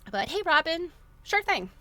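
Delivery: a cheery hello, voice jumping high; bright greeting. Real, not acted. Streamer greets viewer Robin in chat, excited and high.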